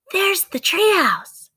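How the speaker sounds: put-on high, slow, bright-eyed girl's voice; little girl Annie. Narrator voicing Annie spotting the treehouse, high and eager.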